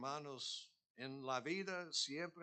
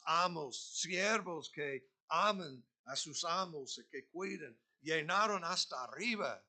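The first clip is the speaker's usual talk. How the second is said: loud, high, driving preacher's voice; full-force preaching; 5 semitones higher, 9 dB louder than usual. Acted. Pastor urging the congregation to serve gladly, loud preaching. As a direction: build it up and hammer it home to the church.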